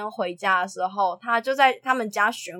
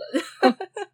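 a burst of high-pitched laughter; 9 semitones higher than usual. Real, not acted. Punchline about 16th floor. (高声爆笑)

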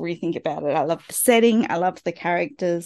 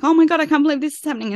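overwhelmed gushing; 9 semitones higher, much faster than usual. Retold or imitated. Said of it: "if you're just completely like".

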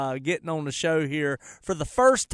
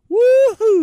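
cheering; 14 dB louder than usual. Retold or imitated. Cheering team's victory.

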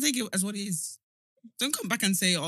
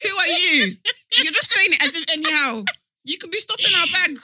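high-pitched, shouted comic outrage; loud indignant scolding; 8 semitones higher, 12 dB louder than usual. Real, not acted. Scolding her bestie for costing them work, loudly indignant. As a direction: scold your bestie at full volume. Indignant challenge to best friend.

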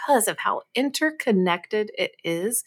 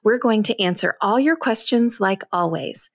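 upbeat, projected host intro; energetic scripted show opener; podcast intro read; 2 semitones higher, 4 dB louder than usual. Acted. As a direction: read the episode teaser brightly, with punched key words.

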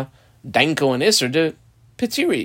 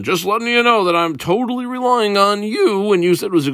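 raised pitch, lightly acted caveat; pointed notice; 7 semitones higher than usual. Reish Lakish tells the rabbi he relies on him, pointed notice. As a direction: put the other on notice, higher-pitched.

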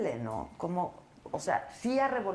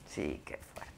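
whispering; 13 dB quieter, much slower than usual. Real, not acted. Sad reply, whispered.

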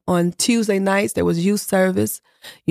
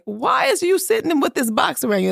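indignant disbelief; 8 semitones higher than usual. Retold or imitated. Mother finding son in box.

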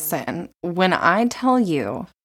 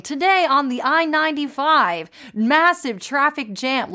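news reporter voice; 7 semitones higher than usual. Retold or imitated. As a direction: put on your best news reporter voice. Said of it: "best news reporter voice".

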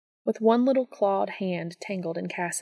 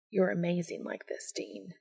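tender; 7 dB quieter than usual. Acted. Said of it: "Cass smiled, meaning it".

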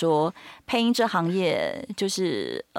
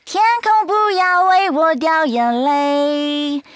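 high, slow singer's voice; [name] impression; 10 semitones higher, 5 dB louder, much slower than usual. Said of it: "他很会模仿桃子". (模仿桃子的声音)

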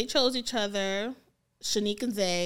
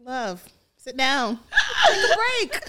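loud, laughing mock scolding; 3 semitones higher, 6 dB louder than usual. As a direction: tease her to sit down.

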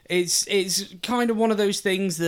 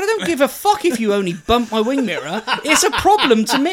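retold heated reply; 4 semitones higher, 6 dB louder than usual.

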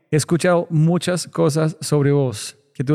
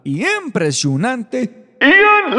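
slow, building announcer intro; grand build-up introduction; hype announcer; 3 semitones higher, slower than usual. Acted. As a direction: drum up the guest like a ring announcer. Hyping the guest introduction.

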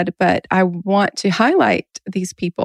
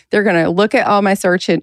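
ordinary delivery

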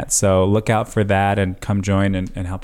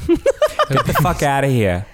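put-on laughing Long Island accent, higher; Long Island goodbye; 5 semitones higher than usual. [name] saying goodbye on request in Long Island accent. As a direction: toss it off like a true Long Islander. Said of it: "as a true Long Islander would say goodbye".

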